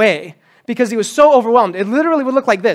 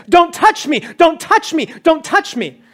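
higher urgent "don't touch me" calls; mock urgent shout, higher; 7 semitones higher than usual. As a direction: warn them off as you run. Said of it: "he would be shouting this".

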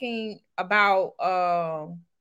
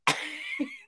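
squealing laugh; high laugh; 6 dB quieter than usual. Real, not acted. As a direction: shriek with laughter. Burst of laughter.

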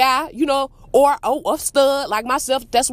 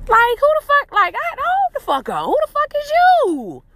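much higher, incredulous "who the fuck"; outraged disbelief; 12 semitones higher than usual. Pretend. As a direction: play it as someone stunned by nerve. Mock outraged rant at entitlement.

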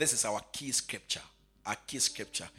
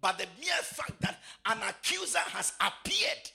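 high, ringing, half-sung preaching; preaching at full force; 11 semitones higher than usual. Acted. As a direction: preach it out to the congregation.

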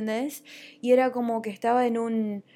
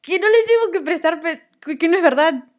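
laughing, louder and higher; laughing it off loudly; 10 semitones higher, 10 dB louder than usual. Real, not acted. Host laughing at how she used to believe her sadness.

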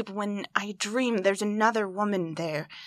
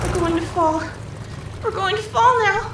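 higher and louder, alarm rising; panic; 9 semitones higher, 3 dB louder than usual. Acted. Woman in a breaking plane crying out in panic. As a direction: cry out as the plane breaks apart.

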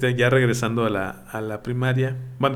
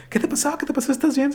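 high, frantic auntie asking if he's hurt; much higher alarmed auntie voice; panicked aunt; 12 semitones higher than usual. Retold or imitated. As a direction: imitate a scared aunt rushing to the kid. Aunt panics over bleeding nephew.

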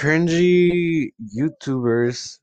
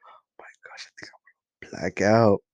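breathless dazed whisper, fading out; faint whisper; 2 semitones lower than usual. Pretend. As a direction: whisper it like you're about to pass out. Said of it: "You're gonna be like".

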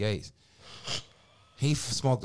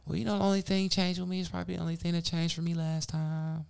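imitating her; 8 semitones higher than usual. Caller answering Mike, high imitation. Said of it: "She's like".

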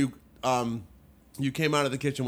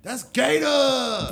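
slow, high, loud shout; 9 semitones higher, 4 dB louder, much slower than usual. Real, not acted. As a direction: belt the word gator. Louder take of the same line.

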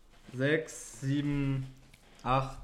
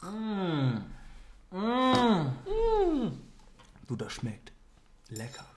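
sipping through a straw; 6 semitones higher, much slower than usual. Real, not acted. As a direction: mouth on a straw while talking. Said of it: "mit diesem Strohhalm-Move".

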